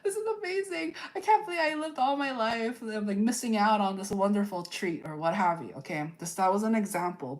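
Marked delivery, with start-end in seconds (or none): 0.0-5.0 much higher, gushing with amazement; thrilled convert raving about pineapple pizza; delighted gush. Retold or imitated. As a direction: squeal like you just found a favorite food. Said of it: "they were like".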